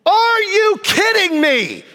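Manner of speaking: high, drawn-out angry yell; retold outrage. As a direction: replay a furious outburst, stretching the words. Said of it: "Oh, I'm at that level".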